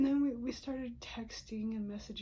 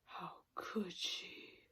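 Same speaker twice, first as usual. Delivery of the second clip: slow, whispered and much softer; shattered disbelief; 3 semitones lower, 7 dB quieter, much slower than usual. Retold or imitated. Reliving the moment she learned of the date, whispered hurt. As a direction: let it fall apart in a hurt whisper. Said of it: "my heart is shattered and I'm like".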